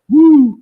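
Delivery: high, loud "woo"; cheering whoop. Real, not acted. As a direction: whoop to hype up the guest. Cheering that everybody wants the guest.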